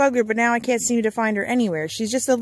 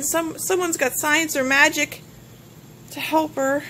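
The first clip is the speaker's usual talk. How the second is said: normal talking voice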